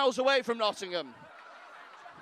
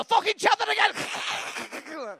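slow, higher, menacing growl of a threat; like a cornered feral cat turning on you; feral cat menace; 8 semitones higher, 5 dB louder, much slower than usual. Retold or imitated. Comic to the chanting crowd, slow feral threat. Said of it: "they just turn around and go".

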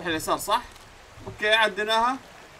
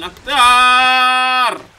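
bطمئن stretched; 7 semitones higher, 12 dB louder, much slower than usual. Pretend. Drawn-out mock reassurance.

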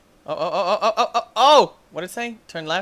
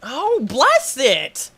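mock blessing; 10 semitones higher, 7 dB louder, much slower than usual. Slow loving high exclamation.

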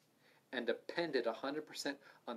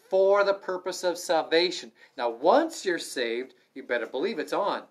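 fiery pulpit emphasis; forceful preaching; 4 semitones higher, 10 dB louder than usual. Acted. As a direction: preach it out hard to the room.

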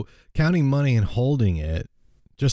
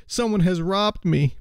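mild wild cry; 9 semitones higher, slower than usual. A narrator's reading. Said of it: "cried the miser wildly".

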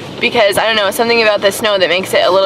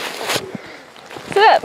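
soft whisper; 10 semitones higher, 5 dB quieter than usual. Real, not acted. Mother to toddler.

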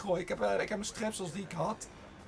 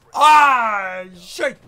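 high, very loud yelled curse; frustrated shout; 5 semitones higher, 20 dB louder than usual. Real, not acted. Gamer caught out in the match, frustrated shout. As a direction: yell and swear at the game. Game goes wrong.

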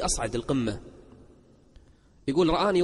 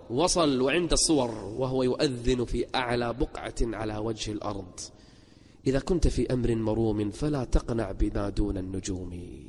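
low, softened preacher's voice; hushed solemn recital; 6 semitones lower, 4 dB quieter than usual. Acted. Preacher honoring the man who reached the summit, hushed solemn recital. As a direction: let it sink low and measured.

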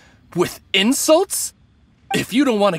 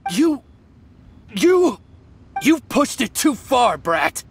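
angry stammer; 4 semitones higher, slower than usual. Acted. Bandit exposed, turns on her.

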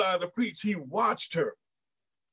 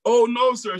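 higher and louder protest; startled denial; 5 semitones higher, 5 dB louder than usual. Retold or imitated. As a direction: gasp it out in denial. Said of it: "she replied".

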